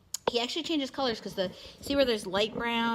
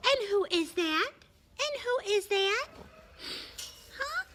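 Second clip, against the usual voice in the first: high, slow pet talk; squeaky praising a dog; 12 semitones higher, slower than usual. Real, not acted. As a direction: coo high and drawn out.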